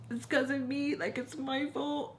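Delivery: mock tearful whine, blaming yourself; crying. Blaming herself to Joe, acted crying. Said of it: "Like, crying, right?".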